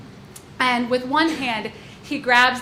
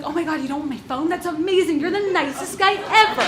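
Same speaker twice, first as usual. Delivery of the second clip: fast, higher, gushing thanks; giddy relief; 7 semitones higher, 4 dB louder than usual. Retold or imitated. To the gunman, fast giddy gushing relief. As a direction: gush like he just did you a favor. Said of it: "immediate Stockholm Syndrome".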